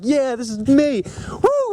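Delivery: high, elated whoop; retold joyful cheer in a high pitch; happy and excited. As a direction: celebrate being yourself, voice leaping up. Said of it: "I was so happy and excited".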